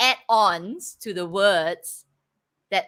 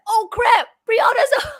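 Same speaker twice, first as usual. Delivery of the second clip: high, loud mock panic; 13 semitones higher, 8 dB louder, slower than usual. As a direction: shout it like you just woke up too late. Imagined people waking up panicking.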